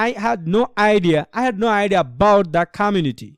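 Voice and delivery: "I had no idea about the community" read slowly, stresses drawn out; stressed syllable demo. Shown as a demonstration. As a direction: lean on "no", "idea" and the "bout" of "about". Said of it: "এভাবে পড়তে হবে". ("I had no idea about the community" ধীরে, জোর দিয়ে)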